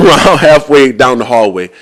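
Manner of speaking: ordinary delivery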